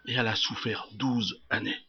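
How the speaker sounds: soft, low and grave; hushed repetition. Acted. Preacher repeating her twelve years of suffering, hushed. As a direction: drop right down to let it sink in.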